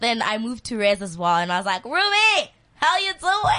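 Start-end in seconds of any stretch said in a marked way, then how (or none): 1.8-3.5 high, excited call to a friend; gushing greeting. Retold or imitated. New res student greeting Ruby, excitedly calling out. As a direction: call out the name, voice way up.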